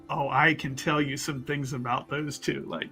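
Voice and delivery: ordinary talk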